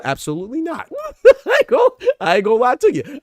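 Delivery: high, loud, laughing through the words; laughing admission. Real, not acted. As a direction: break up laughing while confessing. Admits his bias, laughing.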